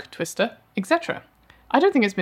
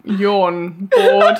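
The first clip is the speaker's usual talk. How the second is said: laughing; 5 dB louder, much slower than usual. Real, not acted. Teasing while laughing.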